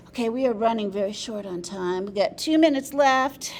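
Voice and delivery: loud announcement, lifting at the end; calling time. Real, not acted. Moderator warns the room time is short, loud announcement. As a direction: call out the time warning over the room.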